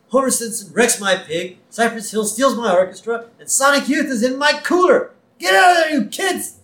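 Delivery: imitated rant climbing to a high yell; pissed-off rocker. Angry rocker scolding the kids in his cooler. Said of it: "And [name] is pissed".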